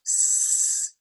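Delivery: hissed S with no voice; long unvoiced hiss; voiceless S. Shown as a demonstration. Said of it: "we produce an S sound".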